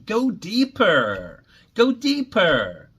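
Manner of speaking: emphatic urging. Real, not acted. Urging listeners to dig deeper.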